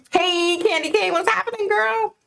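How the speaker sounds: bright squeaky hello to a friend; high sing-song greeting. Real, not acted. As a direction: call out high and playful. Greeting a viewer in chat.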